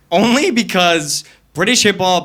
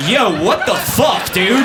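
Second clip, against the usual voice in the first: mock outraged buddy; 5 semitones higher, slower than usual. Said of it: "They'd be like".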